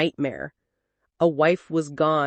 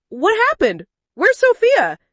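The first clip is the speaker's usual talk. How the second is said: much higher, louder demanding questions; frantic shout; 14 semitones higher, 6 dB louder than usual. Acted. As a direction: shout it in alarm. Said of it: "he shouted".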